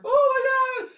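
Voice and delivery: very high, drawn-out wail of fear; hammed-up cowering in terror; mock terrified cry. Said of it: "like, cowering in the corner".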